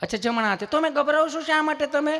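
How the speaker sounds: high, soothing nurse voice; sweet nurse. Retold or imitated. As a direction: coax a nervous patient sweetly. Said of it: "સહજતાથી પૂછતા હોય એમ જ પૂછે".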